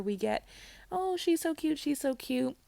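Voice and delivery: normal talking voice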